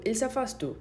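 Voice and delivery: ordinary talk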